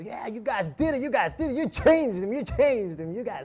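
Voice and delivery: high, loud elated congratulations; excited cheering. Retold or imitated. Passenger cheers monks at their stop, excited shouting. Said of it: "everyone cheers us".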